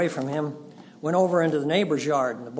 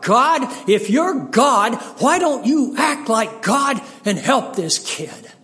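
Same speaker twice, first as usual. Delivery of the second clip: loud, high, slow desperate plea; pleading shout to heaven; crying out to God; 7 semitones higher, 8 dB louder, slower than usual. Retold or imitated. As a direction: cry out to God in anguish. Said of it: "I wanted to cry out".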